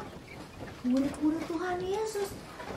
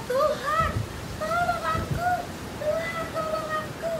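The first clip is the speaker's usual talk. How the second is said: much higher, panicked pleading call; desperate cry for help; 14 semitones higher than usual. Acted. Petrus sinking and crying out to Jesus, panicked high plea. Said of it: "Terus dia berteriak".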